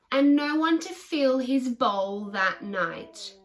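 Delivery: ordinary delivery, nothing marked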